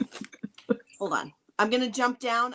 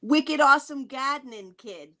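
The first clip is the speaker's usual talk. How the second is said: slower, louder Boston accent, Gadnin without R; Boston accent repeated; 5 semitones higher, 5 dB louder, slower than usual. Shown as a demonstration. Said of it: "It's what?".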